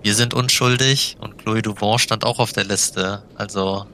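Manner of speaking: normal talking voice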